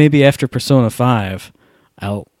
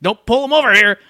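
higher, hurried gamer voice; higher mock Howard panic; 9 semitones higher than usual. Mocking the friend's in-game panic, higher voice. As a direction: push him off in a higher voice.

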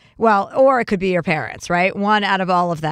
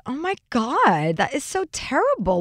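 mock sympathy; 6 semitones higher than usual. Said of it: "And I was like".

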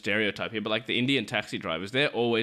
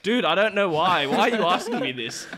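irritated retort; 7 semitones higher, 5 dB louder than usual. Retold or imitated. Annoyed at chatty urinal neighbour.

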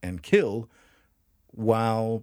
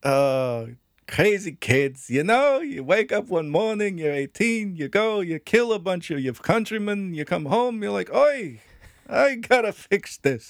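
put-on higher, louder Scottish accent; jokey Scottish voice telling a tale; 9 semitones higher, 4 dB louder than usual. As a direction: spin the story in a hammed-up Scottish brogue. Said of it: "you want me to do it all in Scottish?".

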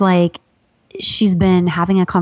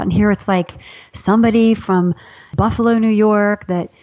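ordinary talk

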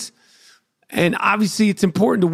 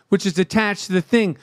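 ordinary delivery

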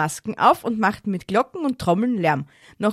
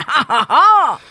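hammed-up laugh; 5 semitones higher, 9 dB louder, slower than usual. Mock laugh after cough talk.

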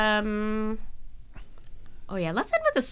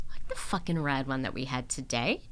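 soft whispered swear; whispered aside; 8 semitones lower, 9 dB quieter than usual. Real, not acted. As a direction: slip it in as a whisper. Sign-off aside.

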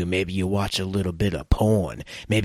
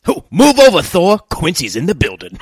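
much higher, louder boast; loud announcing shout; 9 semitones higher, 9 dB louder than usual. Acted. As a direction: belt it like an announcer.

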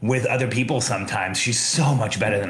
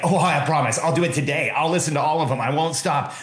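eager promise; 6 semitones higher than usual. Retold or imitated. Boy eagerly promising the garage sale man, high and excited. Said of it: "I remember being like".

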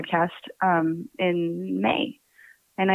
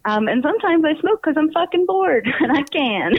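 laughing; 9 semitones higher than usual. Real, not acted. Joking about smoking from boredom.

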